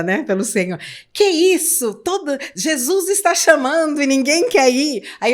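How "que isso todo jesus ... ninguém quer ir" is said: loud, high-pitched indignant protest. Retold or imitated. Girl protesting that nobody volunteers for Jesus, indignant. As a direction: act out outraged disbelief, raised voice. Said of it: "Eu indignada".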